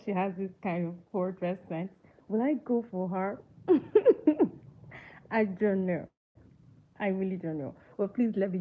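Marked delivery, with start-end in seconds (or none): 3.7-4.6 bright laugh jumping high; quick high laugh, louder; sudden high laugh. Real, not acted. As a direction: burst out laughing at yourself. Laughing at her own question.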